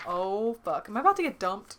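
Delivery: usual speaking voice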